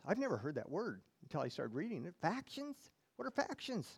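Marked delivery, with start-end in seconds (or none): 2.2-3.9 puzzled. Acted. Puzzling over a strange word.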